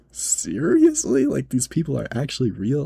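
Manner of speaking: very high, drawn-out "Seriously?"; incredulous. Retold or imitated. Cashier reacting to a demanding customer, incredulous. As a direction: squeal it in stretched-out disbelief.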